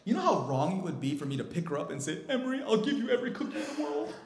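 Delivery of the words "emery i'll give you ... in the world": very high, loud mock-sobbing fuss; pandering parent. Father gives in to his crying daughter, in a ridiculous high-pitched fawning voice. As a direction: frantically soothe a crying child, hammed-up.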